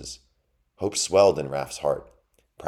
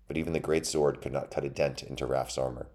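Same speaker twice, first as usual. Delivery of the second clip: ordinary delivery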